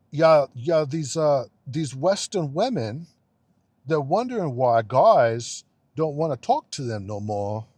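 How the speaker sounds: loud, exaggerated European accent imitation; mock European accent. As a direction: badly put on a European accent to ridicule it. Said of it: "gonna try and do it".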